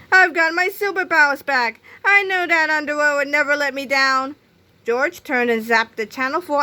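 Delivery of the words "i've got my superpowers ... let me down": higher, louder heroic exclamation; triumphant cry. A narrator's reading. Captain Underpants celebrates getting his superpowers back, triumphant cry. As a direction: proclaim the good news loudly. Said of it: "cried the good captain".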